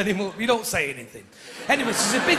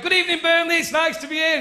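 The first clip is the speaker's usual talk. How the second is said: bright, raised hello to the crowd; chirpy showbiz welcome; cheery stage greeting; 7 semitones higher, faster than usual. Retold or imitated. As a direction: greet them like a hopeful compere.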